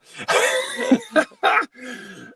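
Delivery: high, loud burst of laughter. Real, not acted. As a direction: crack up loudly mid-impression. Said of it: "I'm laughing too much".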